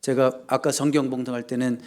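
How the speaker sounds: ordinary talk, nothing marked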